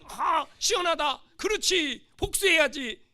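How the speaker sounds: loud, high exclamation of satisfaction; gleeful approval. Acted. Preacher voicing satisfied film viewers, loud relieved approval. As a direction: cheer the revenge on, slow first word.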